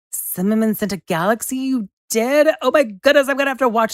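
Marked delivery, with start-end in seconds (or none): 1.6-3.9 high, loud, thrilled disbelief; overwhelmed by a big gift; squealing delight; excited gasp. Real, not acted. Reacting to a galaxy gift.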